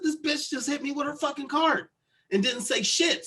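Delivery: high-pitched retold indignation; outraged complaint. Telling mom what the stranger did, outraged. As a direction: protest it, pitched well up.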